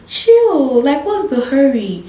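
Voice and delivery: mock-calm pleading, stretched high at first. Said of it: "I was like".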